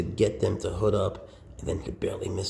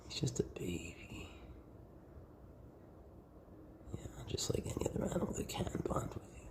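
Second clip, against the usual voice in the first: softer, whispered doting; 6 dB quieter than usual. Real, not acted. Owner whispers praise while petting Fudge, softly. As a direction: whisper lovingly to the pet.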